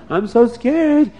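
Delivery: high, slow whimper; mock scared. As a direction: whimper it like a frightened villain. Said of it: "woke up sweating and crying".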